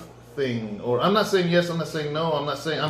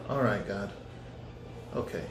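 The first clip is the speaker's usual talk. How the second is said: resigned sigh; 5 semitones lower, 11 dB quieter than usual. Retold or imitated.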